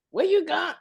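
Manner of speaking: high, squeaky question; high woman voice. Retold or imitated. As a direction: mimic a nosy girlfriend. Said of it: "we have to use that voice".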